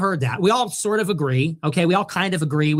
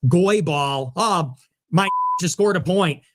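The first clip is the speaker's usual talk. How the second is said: ordinary delivery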